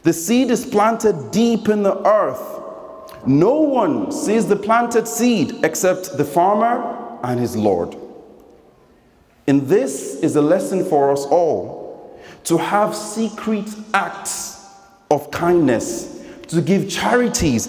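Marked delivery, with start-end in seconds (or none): none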